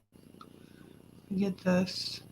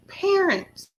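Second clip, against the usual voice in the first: high, stunned repeat; shocked disbelief; 12 semitones higher than usual. Real, not acted. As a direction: say it like you can't believe it.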